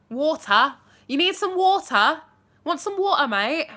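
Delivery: high, loud London accent. Shown as a demonstration. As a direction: offer it loudly like a matey Londoner. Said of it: "like, my London accent".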